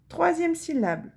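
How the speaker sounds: ordinary talk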